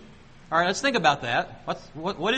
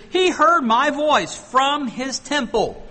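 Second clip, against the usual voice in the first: slow, higher, louder proclamation of the verse; slow and higher preaching cry; emphatic slow reading; 8 semitones higher, 5 dB louder, much slower than usual. Shown as a demonstration. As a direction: proclaim each word slowly.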